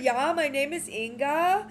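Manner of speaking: hammed-up Swedish "Yeah, my name is Inga"; Swedish accent. Cohost shows off her mock Swedish accent as Inga. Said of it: "I can do it in Swedish".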